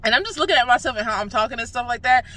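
ordinary talk, nothing marked

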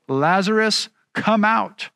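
loud call. Retold or imitated. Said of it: "called in a loud voice".

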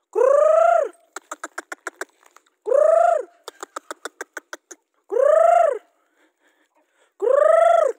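long high loud "Kur" to call the chickens. Real, not acted. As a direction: sing out "Kur" far across the yard. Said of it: "saya cakap kur". (panggilan ayam "Kur" yang panjang, tinggi dan keras)

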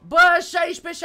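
high, loud exclamation; excited outburst. Real, not acted. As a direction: blurt it out at a shout. Excited guess in a game.

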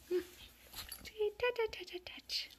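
high, bouncy string of touches; playful patter. Real, not acted. Getting kittens used to touches, high playful patter.

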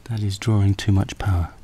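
ordinary talk, nothing marked

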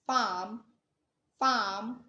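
"Farm" with the r dropped, just a long open vowel; silent r, British. Shown as a demonstration. Teacher showing the UK rule, "Farm" with silent r. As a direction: say "Farm" as "fahm", no r. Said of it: "then r sound is".

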